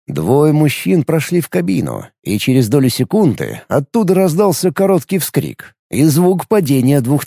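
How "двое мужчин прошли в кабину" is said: ordinary delivery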